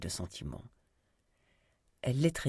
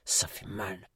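a wounded man's subdued groaned words; pained grumbling; 2 semitones lower, slower than usual. A narrator's reading. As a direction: grumble while hurting. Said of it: "grommela Nigel".

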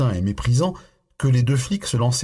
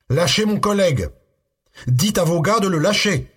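much higher, louder frantic yell; screamed; 10 semitones higher, 4 dB louder than usual. A narrator's reading. Russell screams at the imam to free his colleague, frantic yell. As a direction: demand it with everything you have. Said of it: "hurla-t-il".

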